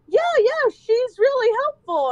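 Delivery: high, loud and bouncy; boy vouches eagerly for his teacher to grandma; eager kid. Retold or imitated. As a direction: gush like a proud little boy.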